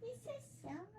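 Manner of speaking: high, soft coo to a pet. Real, not acted. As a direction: coo it up high like talking to a kitten. Cooing to the cat.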